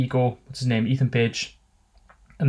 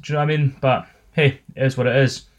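ordinary delivery

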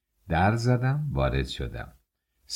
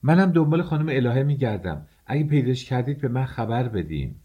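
woman calling down the stairwell, raised and high; 6 semitones higher than usual. Acted. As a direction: call out from upstairs as a woman. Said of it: "صدای یک خانم به گوش رسید".

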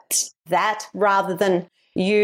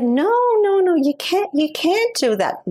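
put-on poor American accent; 9 semitones higher than usual. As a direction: protest in a badly faked American accent. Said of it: "This is my poor American accent".

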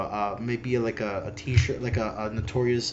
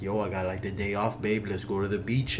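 low, soft Miami-guy voice; casual low murmur to his girlfriend; Miami boyfriend; 5 semitones lower, 7 dB quieter, faster than usual. Acted. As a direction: play the laid-back boyfriend, low and quiet. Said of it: "and, and, like".

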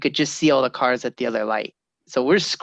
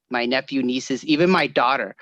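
ordinary talk

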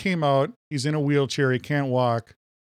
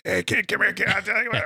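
mock hoarse carny barking at a kid, higher; imitating a worn-out carny calling a kid over; 7 semitones higher than usual. Imitating a carnival barker luring a kid into his booth. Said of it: "They were like".

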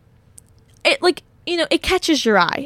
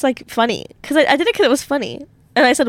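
normal talking voice

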